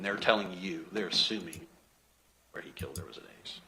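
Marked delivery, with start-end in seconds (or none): 2.5-3.6 whispered scorn; scornful whisper. Real, not acted. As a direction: hiss it softly in disbelief. Scornful hushed aside to jury.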